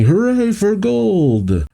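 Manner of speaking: high, drawn-out mock hooray; slow, high-pitched imitation of cheering; cheering audience. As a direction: stretch out a crowd cheer at normal volume. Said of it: "The audience cheers".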